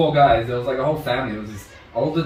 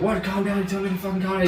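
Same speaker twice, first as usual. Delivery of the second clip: indignant retort, pitched up; mock outrage in a higher voice, not fully shouted; imitated angry yelling; 7 semitones higher, faster than usual. Angry man throws the advice back at the speaker, imitated yelling. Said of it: "He's like, you know, yelling".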